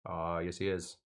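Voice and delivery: low, soft imitation of a teacher's accent; Bob Heaps accent. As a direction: sink into a teacher's accent under your breath. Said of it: "His accent is horrible".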